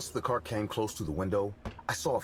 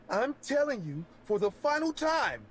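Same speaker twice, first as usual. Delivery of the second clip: angry high MJ voice. Acted. Man angrily insists on name.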